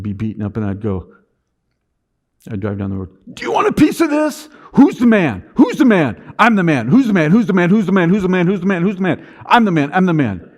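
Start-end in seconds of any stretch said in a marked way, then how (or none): 3.3-10.5 high, loud self hype shouting; roaring chant to himself; pump-up yell. Retold or imitated. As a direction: psych yourself up, yelling in the car. Pumping himself up in the car.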